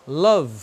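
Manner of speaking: "love" closed on the v; silent final E. Shown as a demonstration. Teacher repeating the model word, silent E in "love". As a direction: end "love" on the consonant, no ee. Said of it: "jamais você pronuncia essa letra E".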